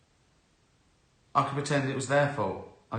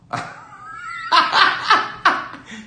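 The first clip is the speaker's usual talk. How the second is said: a burst of high wheezing laughter; real high laugh; 9 dB louder than usual. Real, not acted. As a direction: crack up at the memory. Laughing at the memory.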